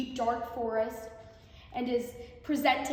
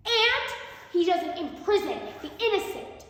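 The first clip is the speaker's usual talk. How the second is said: higher, louder, a frightened plea; shaken goose; 9 semitones higher, 6 dB louder than usual. A narrator's reading. Frightened goose answers the captor king, higher and louder. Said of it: "The gander shakenly said".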